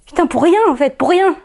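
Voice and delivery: distressed cry at the pointlessness; bitter, exasperated outburst. Acted. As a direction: let the pitch jump in exasperation. Bitter climax of robbery monologue.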